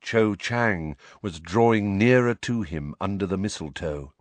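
ordinary delivery, nothing marked

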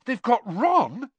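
slow, high, horrified realisation; shocked gasp. A narrator's reading. Harry realising his friend is taken, horrified. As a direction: let the horror sink in. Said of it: "Harry gasped".

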